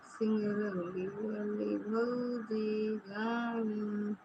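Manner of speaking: slow, drawn-out, soft mock lament; mock wailing. Teasing a friend that bhauji has left, mock wail. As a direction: drag it out like a pretend cry. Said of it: "अब रोवत रहा इसे".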